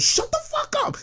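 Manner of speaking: retold yell. Coworker snapping at a bragging designer, high mock yell. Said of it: "she dead out yelled".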